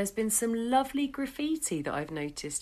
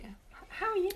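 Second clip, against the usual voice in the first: high strained repeat; 12 semitones higher than usual. Retold or imitated. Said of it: "So you're like".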